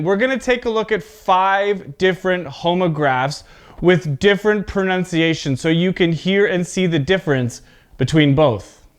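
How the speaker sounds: ordinary talk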